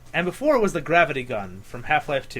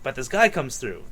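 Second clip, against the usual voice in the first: usual speaking voice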